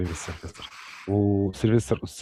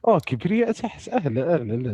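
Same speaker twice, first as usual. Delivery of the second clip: real surprise; 6 semitones higher than usual. Real, not acted. Host spotting Kibriya among the guests, surprised. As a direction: greet with a burst of surprise. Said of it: "مالكم تتفاجئوا كبرياء".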